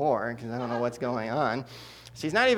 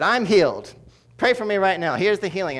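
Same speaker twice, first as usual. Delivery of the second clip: louder, higher, eager announcing; excited declaration; 7 semitones higher, 8 dB louder than usual. Retold or imitated. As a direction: burst out with faith. Grandma declaring healing.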